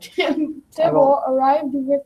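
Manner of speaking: loud laughing while reading. Real, not acted. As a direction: giggle through the line. Reader laughs at name.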